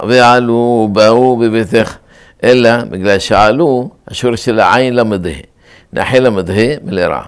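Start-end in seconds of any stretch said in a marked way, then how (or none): 0.1-1.9 ועלו ובאו slowed, both end-stressed, "va-U"; ועלו ובאו chanted slowly with milra stress; stress on last syllables. Shown as a demonstration. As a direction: drag out the final "u" of ועלו and ובאו. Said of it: "או מלרע".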